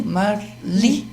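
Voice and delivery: "Marlise" split up, with LI stressed and drawn out. Shown as a demonstration. Professor showing where an accent would go, stressed LI in "Marlise". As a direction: hit LI in "Marlise" hard and long. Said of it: "no li".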